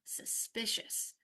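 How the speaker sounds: "suspicious" drawn out slowly and softly; slow drill. Shown as a demonstration. Teacher modelling a word for students to repeat, slowly. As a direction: stretch "suspicious" carefully for repeating. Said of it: "And I say again".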